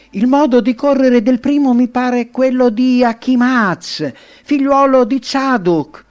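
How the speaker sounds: high, loud call from the walls; sentry calling out. A narrator's reading. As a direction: announce it loudly to someone far below. Sentry calls down to the king. (sentinella che grida dall'alto, voce alta e forte)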